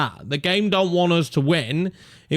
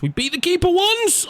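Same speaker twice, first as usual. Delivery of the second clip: pleading excitement. Real, not acted. Urging, voice jumps up.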